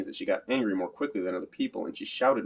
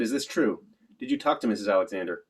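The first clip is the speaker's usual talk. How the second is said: ordinary delivery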